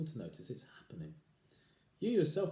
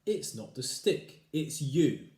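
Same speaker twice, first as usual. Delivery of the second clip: slow, louder gloating; horrible grin; 5 semitones higher, 8 dB louder, slower than usual. Acted. Husband gloating at his wife with a horrible grin. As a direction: savour the blame with a nasty grin. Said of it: "grinning horribly".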